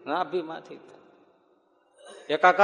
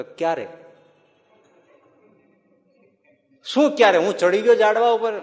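higher, louder bewildered question; surprised disbelief; 7 semitones higher, 9 dB louder than usual. Acted. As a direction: sound amazed he never noticed. Fakir surprised, knew nothing of lion. (નવાઈભર્યો સવાલ)

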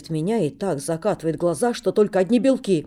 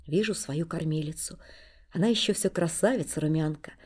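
ordinary delivery

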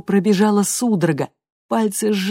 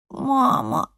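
raspy whisper; 7 semitones higher than usual. A narrator's reading. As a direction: wheeze it out weakly. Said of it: "просипел Энд".

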